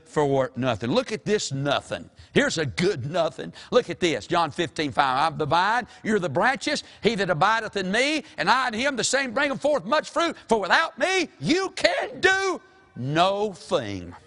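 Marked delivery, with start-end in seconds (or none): 5.3-14.1 high, rising scripture cadence; full-force preaching. Real, not acted. Preacher quoting John to the congregation, full force.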